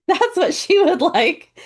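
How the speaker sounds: laughing, high and loud; laughing at her own joke. Real, not acted. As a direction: giggle through it, pitch up. Laughing after quoting Little Sage.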